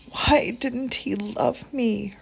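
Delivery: high, slow, hurt squeak. Acted. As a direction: let the voice squeak with pain. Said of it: "her voice squeaked with hurt".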